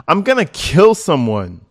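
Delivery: loud, furious read of the text; hammed-up angry threat read off a phone; mock threat. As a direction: shout the threat like she means it. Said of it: "She gets mad".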